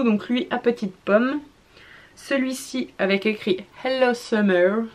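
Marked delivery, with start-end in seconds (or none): 3.8-4.8 French speaker trying an American accent; American English accent. Real, not acted. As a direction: show off a slightly playful American English accent. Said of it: "mon bel accent anglais américain".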